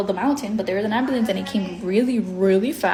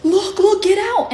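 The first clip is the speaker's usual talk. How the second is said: high, louder mock warning yell; urgent warning call; 10 semitones higher, 5 dB louder than usual. Onlookers warning her as her uncle speeds down, urgent call. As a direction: call out urgently, pitch jumping up.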